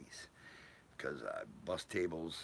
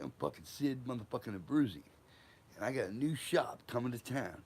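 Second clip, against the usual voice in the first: higher, swaggering boast; imitating Sid bragging; 7 semitones higher than usual. Sid tells the teller about his new shop, high and swaggering. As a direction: brag about the new shop like a big shot.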